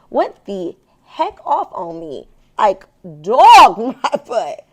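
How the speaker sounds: loud, slow laughing retelling. Real, not acted. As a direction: laugh through it as you tell it.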